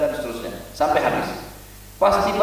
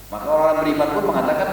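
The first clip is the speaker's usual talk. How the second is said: normal talking voice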